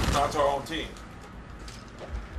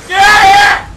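high, loud victory yell; triumphant shout; 8 semitones higher, 10 dB louder than usual. Real, not acted. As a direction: celebrate the kill at full voice. Gamer gets kills.

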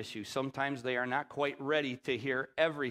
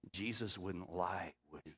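still small voice; 7 semitones lower, 9 dB quieter than usual. Retold or imitated. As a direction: let it land like a quiet nudge. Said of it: "And that voice said to me".

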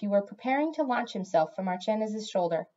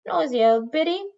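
snide insult; 7 semitones higher, 6 dB louder than usual. Acted. Cat insults witch.

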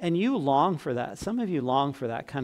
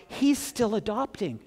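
raised, hushed second take of "He's still adopting"; lifted repeat; 6 semitones higher than usual. Shown as a demonstration. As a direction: lift "He's still adopting" up as a tender echo. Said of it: "repeats phrase for emphasis".

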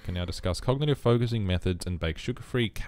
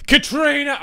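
sudden high, loud call; playful yell; 8 dB louder than usual. Pretend. Player shouting a game character's name for fun, playful yell. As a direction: yell the name out at full volume. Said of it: "I wanted to yell that".

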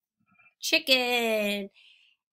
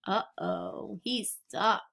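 ordinary delivery, nothing marked

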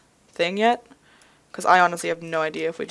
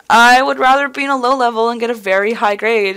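loud, raised mock insistence; emphatic retold reply; 5 semitones higher, 10 dB louder than usual. Student retelling a reply to the teacher, mock insistent. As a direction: declare it loudly over the objection.

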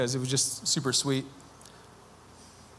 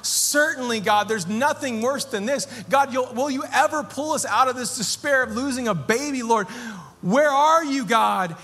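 desperate plea to God; 8 semitones higher than usual. Retold or imitated. Grieving father pleading with God, anguished and raised. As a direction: cry out as if at rock bottom. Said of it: "where we thought".

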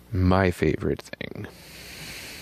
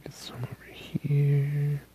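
whispering; 6 semitones higher, 8 dB quieter than usual. Real, not acted.

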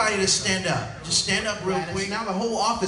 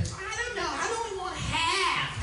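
high, soft, slow coy voice; dainty donut picker; 11 semitones higher, 6 dB quieter, slower than usual. Retold or imitated. Coworker fussing over the donut box, coy and high. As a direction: mince it like you can't decide. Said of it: "you always got that thing".

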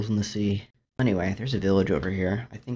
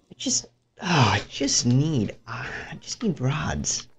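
whiny pleading; 4 semitones higher, 5 dB louder than usual. Real, not acted. Desperate for blaze rods.

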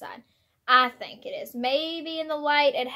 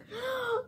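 sharp gasp; 12 semitones higher, 7 dB quieter than usual. Real, not acted. Gasp after tasting.

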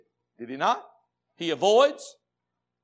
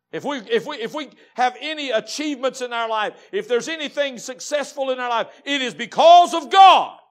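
rising, louder and louder to a loud peak on God; full-force preaching; 6 semitones higher, 11 dB louder than usual. Acted.

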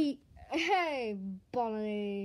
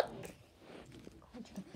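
hushed; 5 semitones lower, 15 dB quieter, much slower than usual. Acted. Coaxing dog in a scary spot.

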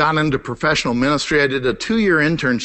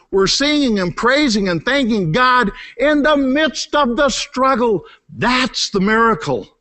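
preaching; 6 semitones higher than usual. Acted. Preaching at full force.

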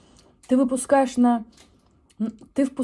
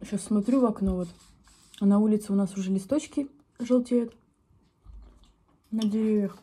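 chewing while talking. Real, not acted. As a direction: mouth partly full. Seen 1: fork of food at lips, bites and chews during words.

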